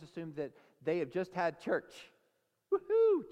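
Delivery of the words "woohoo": high, loud whoop; acted-out burst of celebration; celebrating cheer. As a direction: whoop like a jubilant crowd. Acts out celebrating returned exiles.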